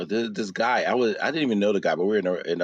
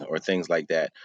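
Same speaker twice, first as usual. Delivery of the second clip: usual speaking voice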